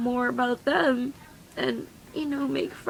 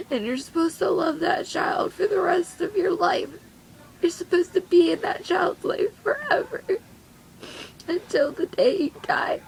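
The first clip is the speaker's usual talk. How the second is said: high, choked-up insistence; tearful; 6 semitones higher than usual. Real, not acted. Teen mom on lifelong love for a child, tearful. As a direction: insist through rising tears.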